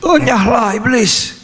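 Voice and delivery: high-pitched dramatic banishing cry; acted rebuke. Re-acts Jesus' rebuke after the reader. (hardikan mengusir dengan nada tinggi)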